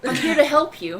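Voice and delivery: Power Ranger. Acted. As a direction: proclaim it like a hammed-up rescuer. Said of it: "Do your Power Ranger shit".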